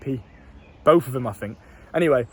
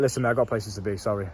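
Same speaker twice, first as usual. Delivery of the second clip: polite brush-off; 7 semitones lower, 5 dB quieter than usual. Retold or imitated. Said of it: "still polite and respectful".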